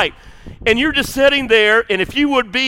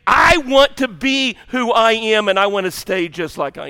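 higher, louder whiny protest as stubborn dough; defiant pizza dough; 4 semitones higher, 4 dB louder than usual. Pretend. Preacher voicing the congregation as defiant dough, whiny protest. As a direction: pout and push back like dough that refuses to change. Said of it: "you're sort of saying".

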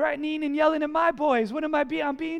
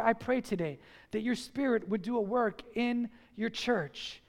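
normal talking voice

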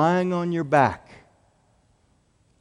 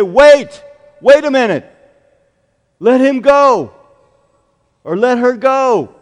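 loud, high urgent call to stop them; urgent shout; 7 semitones higher, 13 dB louder than usual. Acted. Jesus calling to the soldiers to stop, urgent shout. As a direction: cry out to halt the soldiers.

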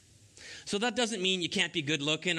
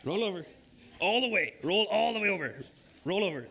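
raised, insistent dog commands; firm dog commands; 3 semitones higher, faster than usual. Real, not acted.

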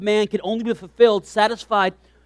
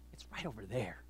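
1 whisper; 11 semitones lower, 24 dB quieter than usual. Acted. Jesus mocking from the rock.

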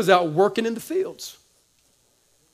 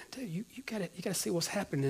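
soft plea; 18 dB quieter, faster than usual. Acted. Father pleading to son.

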